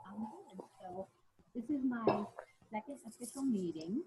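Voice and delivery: distant, faint voice from far off; far from mic. Real, not acted. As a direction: sounds far from the microphone. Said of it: "you sound like you're far, far away".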